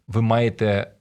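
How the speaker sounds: ordinary delivery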